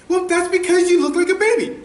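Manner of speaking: high, quick mom impression; imitating mom. Son voices mom's answer.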